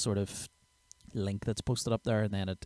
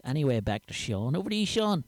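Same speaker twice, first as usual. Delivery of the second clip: Rodney; 4 semitones higher than usual. Acted. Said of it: "Thanks, Rodney".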